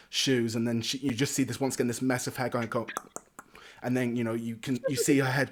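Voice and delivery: normal talking voice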